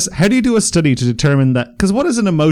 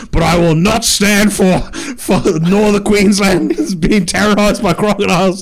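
slower, higher mock outrage, a bit louder; indignant politician; 6 semitones higher, 3 dB louder, slower than usual. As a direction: ham up an angry Bob Katter. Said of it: "and he goes".

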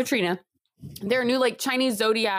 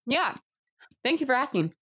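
words round a bite of food; chewing mid-sentence; mouth full; 4 semitones higher, faster than usual. Real, not acted. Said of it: "Let's give you your time to chew".

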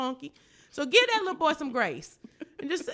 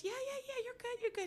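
put-on much higher, very soft boy's voice; son's reply; 13 semitones higher, 11 dB quieter than usual. Son reassuring his mom, high and soft. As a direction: squeak it out like a kid. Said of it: "And he goes".

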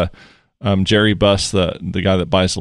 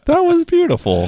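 high mock praise.

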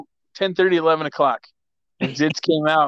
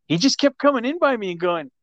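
high mock pestering whine; quoting her imitation; 9 semitones higher than usual. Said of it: "She goes".

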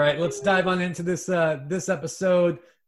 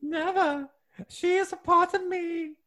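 much higher falsetto of a prim British nanny; a fussy Scottish-nanny falsetto, teasing; Mrs Doubtfire impression; 13 semitones higher than usual. [name] teasing his scared travel partner, falsetto Mrs Doubtfire. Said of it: "put Missus Doubtfire away".

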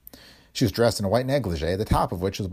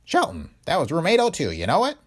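lightly voiced old Willie; 8 semitones higher than usual. A narrator's reading. Willie tells Spooner.

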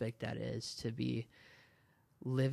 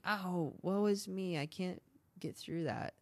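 whiny mock lament, pitched higher; mock self-pity; 7 semitones higher than usual. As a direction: poke fun at someone moaning woe is me.

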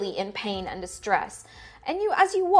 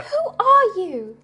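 high, louder suspicious question; wary prisoner; 12 semitones higher, 4 dB louder than usual. Acted. Prisoner challenging the new visitor, high and wary. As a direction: demand it in a high character voice.

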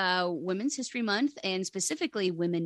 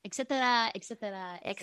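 Edna Mode impression; 3 semitones higher, much faster than usual. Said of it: "a good Edna Mode accent".

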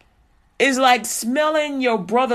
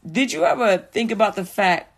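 ordinary talk

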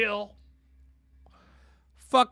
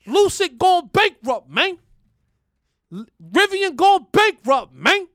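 boardroom boasting; 7 semitones higher, 9 dB louder than usual. Pretend. Host acting himself in the boardroom, loud higher swagger. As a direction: lord it over the room. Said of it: "it's kinda just like this".

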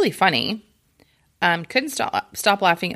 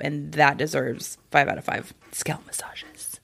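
whisper; 4 semitones lower, 6 dB quieter than usual. Pretend. Whispered joke rating.

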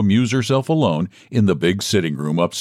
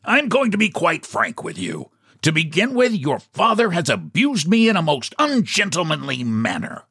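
higher-pitched, lightly voiced indignant salesman; a hint of an affronted gentleman; aggrieved visitor; 7 semitones higher than usual. A narrator's reading. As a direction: lay out a grievance with a touch of pomp.

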